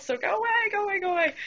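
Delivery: very high, desperate repeated plea; panicked plea. Retold or imitated. Server pleading with the food runner to leave, very high. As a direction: shoo him off in mock panic.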